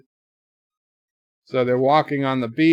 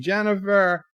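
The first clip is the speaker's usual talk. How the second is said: yelling her name; 8 semitones higher than usual. Retold or imitated. Sisko losing Jennifer as the orb fades, yelling her name. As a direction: shout after her as she disappears. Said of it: "and he's yelling".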